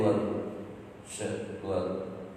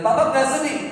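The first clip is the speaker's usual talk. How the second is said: wife's worried question; 13 dB louder than usual. Retold or imitated. Wife voiced asking husband.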